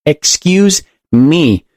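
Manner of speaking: high, very drawn-out demand; insistent call. A narrator's reading. Woman with client waiting, insistent call. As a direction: stretch it out to make them move.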